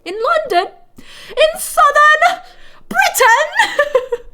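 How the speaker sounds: very high, loud, incredulous posh Brit; mock British accent. Streamer reacting to a British viewer's pub story, high mock British accent. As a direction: squeal the place names in a hammed-up British accent. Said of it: "O M G, I'm British".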